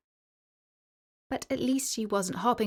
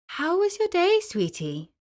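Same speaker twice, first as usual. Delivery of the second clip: caring mum; 10 semitones higher, 3 dB louder than usual. Acted. Mother greeting daughter.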